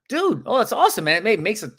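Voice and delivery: high, thrilled exclamation; sounding excited. Acted. Agent just told no by a prospect, excited. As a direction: leap up in pitch as if delighted. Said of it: "You wanna sound excited".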